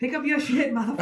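high, laughing mock tough-guy line; a laughing, hammed-up Samuel Jackson bark; Samuel Jackson impression. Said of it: "Like Samuel Jackson or something?".

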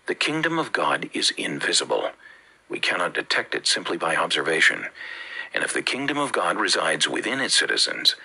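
usual speaking voice

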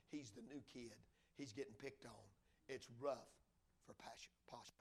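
low, very soft sermon narration; hushed storytelling. A narrator's reading. Preacher telling of a boy alone in an orphanage, hushed. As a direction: drop low and quiet for the sad part.

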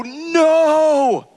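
high, drawn-out wail of "no"; long dismayed cry; crying out in retelling. Retold or imitated. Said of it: "crying out to him".

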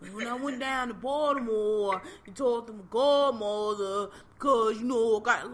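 slow, exaggerated Baltimore accent; poking fun at a thick Baltimore accent; mock Baltimore accent. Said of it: "that Baltimore accent remains trash".

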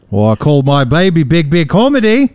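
loud, high Australian parody with rising end; mock Australian accent. Shown as a demonstration. Co-host joking about a fan's baby name, loud mock Australian accent. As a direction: put on a broad Australian uptalk. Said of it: "Australian accent".

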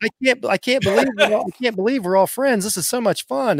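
high-pitched, excited mock gushing; gushing partygoer. Mocking friends at a party, gushing excitedly. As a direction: imitate someone thrilled with all their friends.

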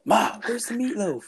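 high, slow pleading call to mom; high, slow plaintive movie-quote call; Will Ferrell Old School. Retold or imitated. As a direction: whine it up high for Ma. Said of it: "that Old School with Will Ferrell".

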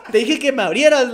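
loud acted telling-off; angry retold scolding. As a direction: berate the friend who locked you out. Retold scolding at locked window.

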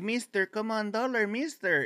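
put-on high whiny little kid voice; begging kid. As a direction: plead like a street kid chasing a tourist.

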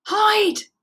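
high, urgent cry; alarmed high call; shouted warning. A narrator's reading. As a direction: yell for everyone to take cover. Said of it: "shouted Teddy".

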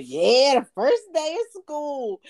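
high, loud mock cheer; hammed-up celebration; mock excitement. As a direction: imitate an overexcited parent's announcement. Mimics parents' posts on social media.

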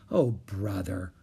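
low, slow weary groan; exasperated. A narrator's reading. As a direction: drag it out, fed up. Jack exasperated at Annie.

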